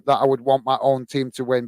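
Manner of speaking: normal talking voice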